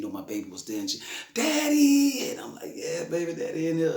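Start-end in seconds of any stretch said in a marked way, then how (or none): 1.3-2.3 high, loud delighted cry; surprised shout. Retold or imitated. Daughter surprised as father walks in, loud delighted shriek. 2.7-4.0 cheerful greeting. Retold or imitated. Father greeting daughter.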